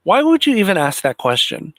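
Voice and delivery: indignant disbelief; exasperated outburst. Real, not acted. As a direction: blow up at the reporter's dumb question. Ranting at a reporter's question.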